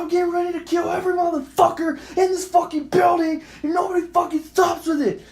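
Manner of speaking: high, raging re-enacted threat; retold going off. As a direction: rant at the whole building. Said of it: "And I'm fucking going off".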